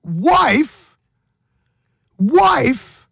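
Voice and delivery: high, stunned disbelief at the news; mock-shocked cry of wife. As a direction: gasp it out, wide-eyed disbelief. Shocked by friend's marriage news.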